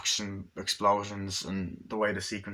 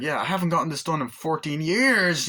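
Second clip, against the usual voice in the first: raised, loud retold protest; mock loud complaint; 7 semitones higher, 5 dB louder than usual. Patient complaining during an injection, mock loud protest. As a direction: complain at high volume, acting the patient.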